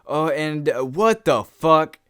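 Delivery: slow, loud mock shock on catching them; outraged mom. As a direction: exclaim it like an appalled mother. Mom walks in on them, retold.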